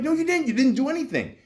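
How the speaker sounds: high-pitched indignant retort; arguing. Retold or imitated. Patient argues with doctor in the hallway, indignant. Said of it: "So we're arguing".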